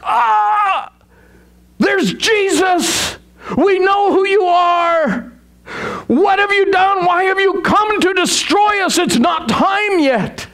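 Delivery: high, shouted, terrified demon voice; preacher voicing a demon who is terrified of Jesus; panicked demon. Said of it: "The panic in the demon's voice".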